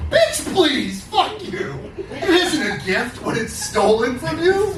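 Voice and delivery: angry cow voice in a slam poem; slam poetry performance. Acted. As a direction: rage at them through the poem as the cow.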